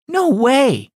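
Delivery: Tiffany amazed that Jesus cried, high and surprised; surprised girl. Acted. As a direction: blurt it out in disbelief.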